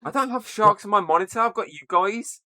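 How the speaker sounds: high-pitched indignant denial; mock protest. Real, not acted. Teased about sharks on his monitor, mock-indignant protest. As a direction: protest to your friends, pitched well up.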